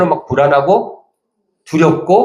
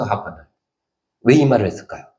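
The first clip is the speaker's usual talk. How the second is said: normal talking voice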